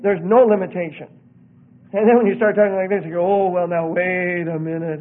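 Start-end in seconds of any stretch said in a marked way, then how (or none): 3.3-5.0 slow, drawn-out hedging; mock hesitant objector; backpedalling objection. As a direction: stall as you back down. Voices people backing down when challenged.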